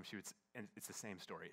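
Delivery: usual speaking voice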